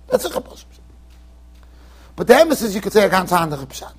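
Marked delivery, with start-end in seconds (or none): 0.1-0.8 breathy whisper with no voice; whispered repeat. Shown as a demonstration. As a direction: drop to a secret whisper.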